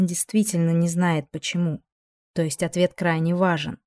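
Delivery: usual speaking voice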